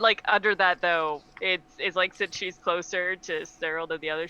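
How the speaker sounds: normal talking voice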